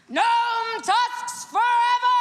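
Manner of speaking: rally the crowd; hype shout. Real, not acted. Host hyping shout out.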